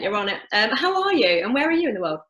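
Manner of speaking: ordinary talk, nothing marked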